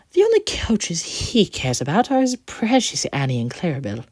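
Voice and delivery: whispered mockery; mocking tone. The coach mocks Thomas's Annie and Clarabel in a whisper. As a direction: sneer it under the breath. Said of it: "the last part in a mocking tone".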